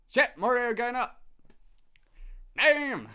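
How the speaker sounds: high, loud joyful outburst; real excited yell. Real, not acted. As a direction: burst out at the good news. Excited at game stats.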